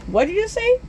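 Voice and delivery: high-pitched, incredulous question; alarmed question. Retold or imitated. Boy catching the Grinch's remark, high alarmed question. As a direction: shoot the pitch up in disbelief. Narrator challenges the Grinch's muttering.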